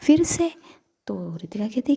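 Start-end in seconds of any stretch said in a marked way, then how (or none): 0.0-0.5 higher, louder outburst of dismay; loud shocked cry. Acted. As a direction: blurt it out, shocked it's this again. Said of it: "ऐसे ज़ोर से बोलती है".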